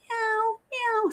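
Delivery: high, squeaky kitten meows; playful high cat mewing; imitated cat meows. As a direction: mew like the shelter cats calling out. Cats meowing at her in shelter.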